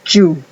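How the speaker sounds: you said as "joo" with a hard j; you begun like the first sound of Joe. Shown as a demonstration. Teacher isolates the J sound for learners, you as "joo". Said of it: "el sonido".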